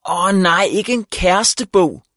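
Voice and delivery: high, slow dismayed outcry; groaning exclamation. A narrator's reading. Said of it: "udbrød Viggo og Mylle i kor".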